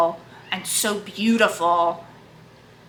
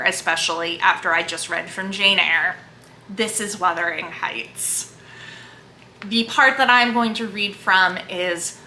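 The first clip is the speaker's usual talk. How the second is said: ordinary delivery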